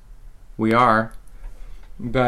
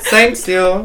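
high mock-Brazilian accent sliding into German; botched Brazilian accent; 7 semitones higher, 4 dB louder than usual. Brazilian character thanks co-host, botched accent. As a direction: put on a silly foreign accent. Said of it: "my Brazilian guy is German".

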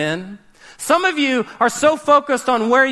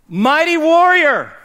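louder and higher, drawn out; proclaimed call; 6 semitones higher, 6 dB louder, slower than usual. Retold or imitated. God calling the failing Gideon a warrior, proclaimed loudly. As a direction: ring it out like a proclamation.

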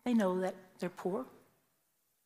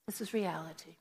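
softer, settling the point; softer repeat of this is reality; soft sober repeat; 3 semitones lower, 8 dB quieter than usual. Real, not acted. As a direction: let it sink in quietly. Repeated for emphasis.

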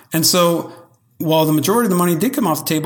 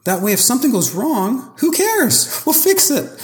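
carefree; 11 semitones higher than usual. Real, not acted. Breezy no-big-deal attitude.

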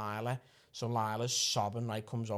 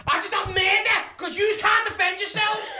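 high, loud scolding; exasperated yelling; 10 dB louder than usual. Acted. Dad telling off his kids after the row, shouting. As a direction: yell it in outraged exasperation.

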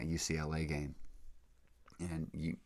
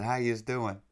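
higher, louder Jersey greeting; Jersey accent; 6 semitones higher, 5 dB louder than usual. Retold or imitated. Retelling his hello to Saint Peter's fans, Jersey accent. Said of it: "I talk with a Jersey accent".

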